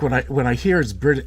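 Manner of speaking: ordinary delivery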